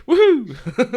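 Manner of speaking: loud high whoop; excited whoop. Real, not acted. Cheering about wearing a dog costume, loud high whoop.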